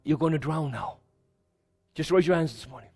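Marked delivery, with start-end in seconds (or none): 0.0-1.0 the devil's taunt. Acted. Pastor voices the devil taunting the drowning believer, low and soft. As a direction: lower and much softer, a menacing murmur. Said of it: "you can hear him say".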